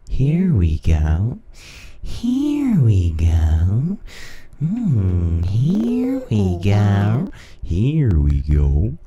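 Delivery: slow stretched pitch-shifted here we go; pitch-shifted voice effect. Shown as a demonstration. Testing the pitch shift effect, slow warped here we go. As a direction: drag it out through the pitch shifter. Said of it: "pitch shift".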